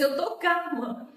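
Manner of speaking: high, loud, drawn-out claim of calm; agitated insistence. Retold or imitated. Sister answering woman who said calm down, loud agitated retelling. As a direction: stretch it out, protesting too much.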